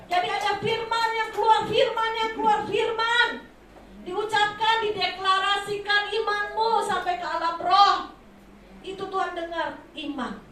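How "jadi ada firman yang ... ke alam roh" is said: loud, high impassioned preaching; fiery preaching. Acted. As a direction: proclaim it with rising fervor. Preaching at full force.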